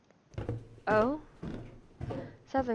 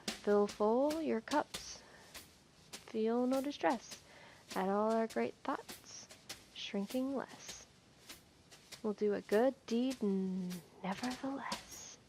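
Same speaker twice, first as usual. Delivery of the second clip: slow, softer poem reading, fading away; 3 dB quieter, slower than usual. Acted. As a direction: read the label verse gently and slowly. Reads poem from label.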